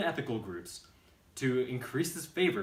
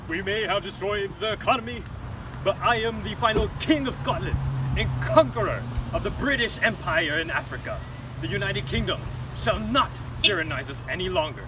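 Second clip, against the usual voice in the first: loud, higher grandiose boast; boastful dictator; 7 semitones higher, 8 dB louder than usual. Acted. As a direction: crow like a proud tyrant.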